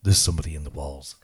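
low, loud ghostly growl in the ear; deep spectral voice right by the ear; proper ghostly voice. Retold or imitated. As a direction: drop it low and menacing like a voice from the walls. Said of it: "But it was like proper".

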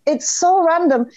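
ordinary talk, nothing marked

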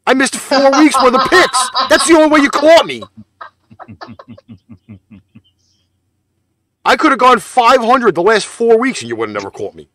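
loud, high-pitched protest; indignant outburst. Real, not acted. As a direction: shout your excuse at your rival. Angry excuse for losing the league.

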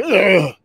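high mock groan of an obnoxious guy; mocking grunt. The guy answering my brother, mocking high groan. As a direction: imitate a loudmouth's dismissive "ugh". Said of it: "And, and the guy's like".

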